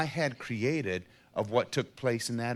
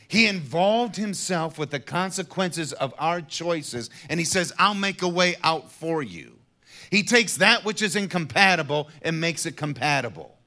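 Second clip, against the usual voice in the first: rising, louder preacher's build; preaching at full force; 5 semitones higher, 5 dB louder than usual. Acted. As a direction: preach it out, climbing higher. Preaching rises again.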